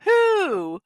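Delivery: high, mock owl hoot on who. Streamer hooting playfully at chat like an owl. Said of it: "Someone said I sound like an owl".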